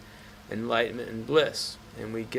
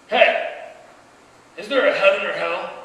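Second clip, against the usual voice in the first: loud, higher-pitched warrior's challenge; raised voice, pitched up, hailing someone; calling out; 7 semitones higher, 9 dB louder than usual. Acted. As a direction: call out the question like a demanding warrior. Warrior calls out to monk.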